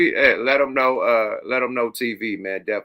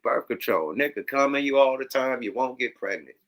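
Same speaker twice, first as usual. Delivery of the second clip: usual speaking voice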